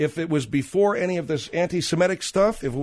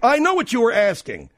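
irritated, louder snap; angry snap; 7 semitones higher, 4 dB louder than usual. Real, not acted. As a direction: bite back at the dumb question. Host snapping at sidekick.

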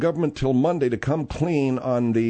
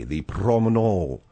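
put-on French accent; 5 semitones lower, slower than usual.

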